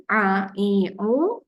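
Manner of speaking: "a, i, u" squeezed from mid-throat, Arabic ain; Arabic pharyngeal ain on each vowel; pressed ain sound. Shown as a demonstration. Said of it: "Tekan sedikit di tengah kerongkong".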